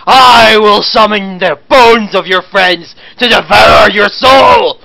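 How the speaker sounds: slow, loud, booming villain threat; evil necromancer voice. Acted. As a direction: roar it like a dark sorcerer. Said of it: "in an attempt to make me sound like an evil necromancer".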